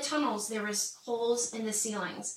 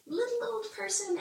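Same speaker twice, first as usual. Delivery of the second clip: tiny, very high little voice; little old person; 11 semitones higher than usual. Retold or imitated. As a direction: squeak it as a small mock character. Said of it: "So you're, like".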